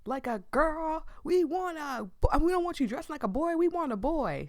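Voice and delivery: hammed-up cranky grandparents scolding; poor old man impression. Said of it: "He says this in a funny accent, as if he is intentionally doing a poor impression of an old man".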